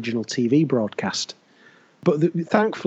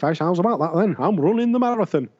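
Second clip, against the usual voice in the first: higher Savile catchphrase voice; hammed-up TV presenter patter; Jimmy Savile impression; 5 semitones higher than usual. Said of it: "That's Jimmy".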